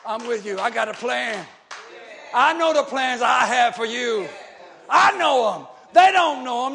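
loud, driving preacher's refrain; fiery pulpit preaching; preaching at full force. Acted. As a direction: hammer each "I'm with you" home to the congregation. Preacher's rising litany.

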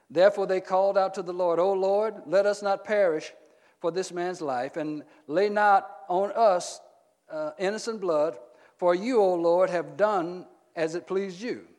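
loud, emphatic Bible reading; forceful scripture reading. Acted. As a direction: proclaim the verse to the congregation. Reads scripture aloud to congregation.

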